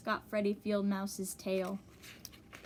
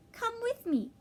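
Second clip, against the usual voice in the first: much higher, eager bird voice; high little bird; 13 semitones higher than usual. Acted. Early Bird invites Wiggly Worm home, high and eager.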